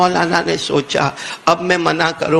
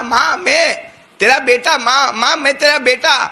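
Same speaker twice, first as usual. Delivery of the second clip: son's pleading; 6 semitones higher than usual. Acted. Son pleading at the door.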